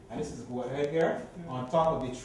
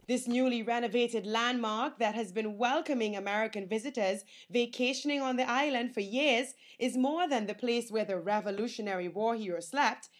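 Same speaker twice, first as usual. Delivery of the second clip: bright, energetic TV presenter read; news reporter; 10 semitones higher, 6 dB louder than usual. Acted. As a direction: deliver it like a travel-show host. Reporter's upbeat stand-up.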